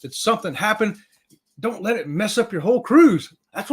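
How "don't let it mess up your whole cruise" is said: normal talking voice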